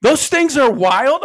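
loud, high, drawn-out question; imitated city slicker's disbelief; incredulous. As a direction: stretch it out in mock astonishment. Disbelieving relative voiced in story.

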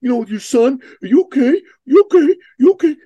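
high, loud, mock-worried fussing; over-concerned dude. Dude checking on a dad about his son, over-concerned. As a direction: pester with exaggerated, high-pitched concern. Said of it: "this one dude comes up".

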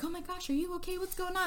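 high, fluttery worried voice; a hammed-up fretful high pitch; mock concerned friends. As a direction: play the panicked friends. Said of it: "they're all like".

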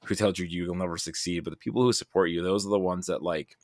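ordinary delivery

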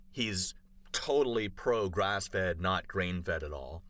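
ordinary delivery

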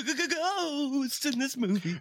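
high, slow, stammering spooky voice; spooky ghost voice. Acted. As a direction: stutter it like a scared ghost story.

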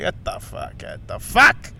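mild mock-angry nurse, hushed then rising sharply. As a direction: imitate an exasperated nurse, voiceless and building. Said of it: "she's so angry at me".

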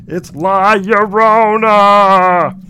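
loud, very drawn-out dread; failed stage whisper, stretching the name out; Raymond. Acted. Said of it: "he whispers".

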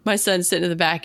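normal talking voice

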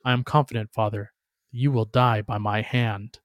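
ordinary delivery

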